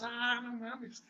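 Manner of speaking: high, slow, drawn-out mock cry; panicked drunk students fleeing the building, slow high mock cry; imitated scared cry. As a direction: stretch out a frightened high wail. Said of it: "gritando, bien asustados".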